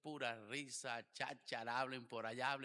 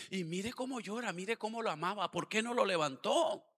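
raised, scornful gossiping voice, higher; mocking mourners; 6 semitones higher, 7 dB louder than usual. Pastor mimics wake guests sneering at Jesus, mocking. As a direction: jeer like nosy wake guests.

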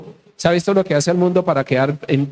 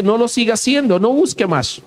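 raised-pitch acted discouragement; devil's urging; 7 semitones higher than usual. The devil telling a believer to stop, insistent urging. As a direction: urge them to give up, higher than usual. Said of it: "y lo anima".

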